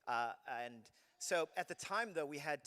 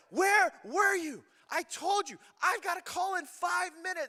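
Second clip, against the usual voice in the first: angry shout; 12 dB louder than usual. Retold or imitated. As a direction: shout at the late wife. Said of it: "I am angry".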